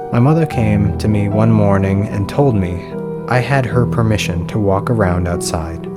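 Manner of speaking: plain reading voice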